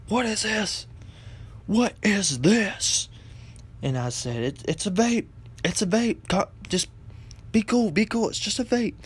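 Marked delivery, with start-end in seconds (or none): 0.1-3.1 high-pitched, outraged mock mom; mom going off. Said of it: "so she's going off". 4.4-8.9 high-pitched, placating retold plea; defensive pleading. Son placating his angry mother.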